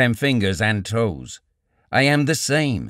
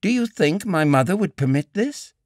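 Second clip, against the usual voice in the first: lightly voiced higher woman, indignant; young woman pleading; 7 semitones higher than usual. A narrator's reading. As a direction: play her slightly higher, offended.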